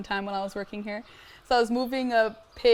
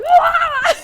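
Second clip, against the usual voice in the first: a sharp, very high scream; 13 dB louder than usual. Retold or imitated. Girl finds a centipede on her stomach, very high scream. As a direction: shriek in panic at the bug. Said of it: "I'm freaking out".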